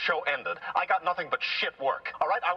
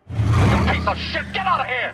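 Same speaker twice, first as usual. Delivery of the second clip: furious shout; 4 semitones higher, 9 dB louder than usual. Acted. Car screaming at the rude fan, furious. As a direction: roar at him to get out.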